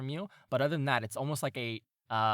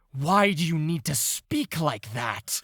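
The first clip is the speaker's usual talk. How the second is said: louder, higher exasperated cat; annoyed cat; 4 semitones higher, 7 dB louder than usual. Acted. Other cat mocks the pompous one, loud and annoyed. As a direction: roll your eyes at your partner. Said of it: "And then the other cat goes".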